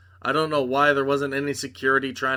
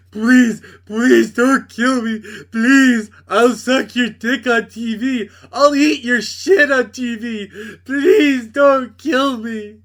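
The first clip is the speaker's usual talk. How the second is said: high, loud, hammed-up crying plea; mock whimpering beg; 9 semitones higher, 10 dB louder, slower than usual. Homelander begging Butcher for his life, hammed-up sobbing plea. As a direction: wail it like a pathetic villain begging. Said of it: "And Homelander is like".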